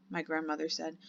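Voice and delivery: plain reading voice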